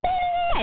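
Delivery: high, sharp mock baby wail; baby scream. Voicing the baby screaming in his face, high mock wail. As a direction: squeal like a startled baby. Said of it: "was like".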